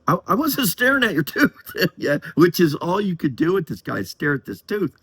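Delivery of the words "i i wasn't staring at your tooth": higher, flustered mock-apology of the embarrassed woman. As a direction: imitate the gal hurriedly denying she stared. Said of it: "she got real embarrassed".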